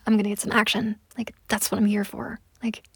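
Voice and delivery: ordinary talk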